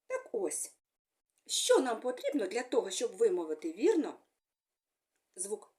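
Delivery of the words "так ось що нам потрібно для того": ordinary delivery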